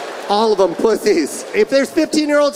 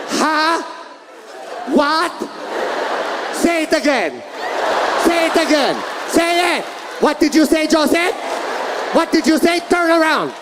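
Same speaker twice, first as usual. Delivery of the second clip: higher mock yelling mother; mom yelling; 6 semitones higher than usual. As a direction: bark questions like his angry mom. Voicing mom yelling at him.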